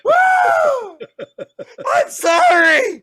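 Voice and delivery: high, loud, slow laughing yells; high screaming laughter; laughing panicked shriek. Retold or imitated. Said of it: "I was like".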